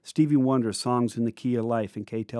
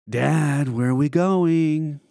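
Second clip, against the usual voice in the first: spooked; 4 semitones higher, 4 dB louder, much slower than usual. Retold or imitated. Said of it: "We were spooked".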